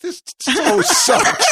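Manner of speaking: high, slow, wailing fake sob; mock crying. Host showing his co-host how to cry on air, hammed-up sobbing. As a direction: ham up a tearful breakdown. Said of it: "I'll show you how this crying thing is done".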